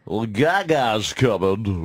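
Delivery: slow, drawn-out cheesy DJ read; mock radio DJ voice. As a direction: ham it up like a corny radio host. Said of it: "the voices".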